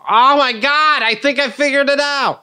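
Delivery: high, bright-eyed daughter voice; excited kid. Pretend. Daughter returning from the kitchen, announcing her breakthrough, high and delighted. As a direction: play the kid thrilled by her discovery. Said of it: "and goes".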